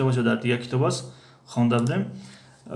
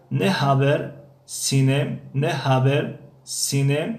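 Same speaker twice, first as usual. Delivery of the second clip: slow model phrase; slower than usual. Shown as a demonstration. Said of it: "teacher models phrase slowly".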